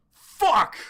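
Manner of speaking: sudden loud high yell; startled loud swear; shouted curse. Acted. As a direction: blurt it out at full volume. Sudden outburst at the shot.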